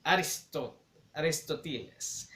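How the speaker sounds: put-on Aristoteles in a formal Mexican Spanish accent. A narrator's reading. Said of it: "with this really formal Mexican accent".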